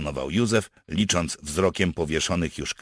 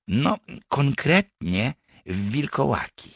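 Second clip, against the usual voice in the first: high-pitched, hesitant clarification ending in a fall; specify it, landing firmly downward; explaining; 10 semitones higher than usual. Acted. Partisan clarifies what captives will become, explaining. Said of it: "wyjaśnił Jakub".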